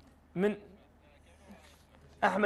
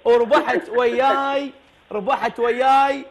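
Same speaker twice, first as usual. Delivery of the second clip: booming prize announcer; 4 semitones higher, slower than usual. Acted. Host announces prize win.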